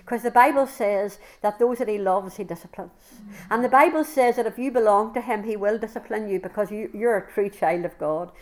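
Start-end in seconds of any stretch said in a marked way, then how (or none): none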